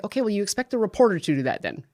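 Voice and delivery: a playful jab with a chuckle behind it; sarcastic retort. Retold or imitated. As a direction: needle them with a dry, mocking reply. Retorting to experts who can't summarize.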